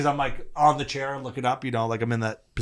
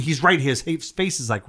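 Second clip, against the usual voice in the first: normal talking voice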